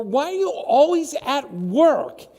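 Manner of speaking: put-on high, loud and drawn-out child's complaint; slow whiny child. Child asking absent parent why, slow high plea. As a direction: stretch it out like a kid who misses you.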